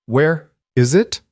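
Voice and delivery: slow, halting first words; halting, unpracticed. A narrator's reading. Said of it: "The words were halting".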